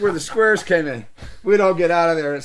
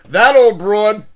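loud, higher admiring call; admiring shout; 5 semitones higher, 12 dB louder, slower than usual. Retold or imitated. Mike praising the actress to the bar, loud. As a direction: praise her loudly. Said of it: "he goes".

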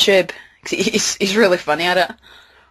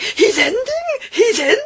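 high wailing cry; mock-sob the line like a heartbroken fan; crying "he's ending". Fan wailing at the show's ending. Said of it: "it's just like".

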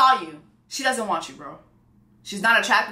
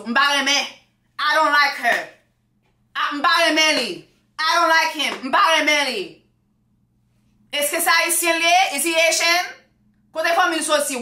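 high-pitched, disapproving Haitian mother; Haitian mom; 6 semitones higher than usual. Pretend. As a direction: play a picky mom vetting her son's girlfriend. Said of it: "Haitian moms are very particular".

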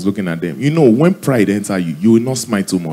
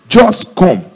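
higher, insistent summons; pastor's altar call; 7 semitones higher, 4 dB louder than usual. Acted. Pastor calling a giver forward, insistent. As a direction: urge it like a preacher. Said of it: "the pastor said".

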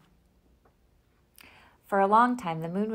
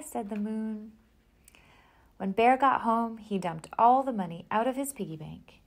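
plain reading voice

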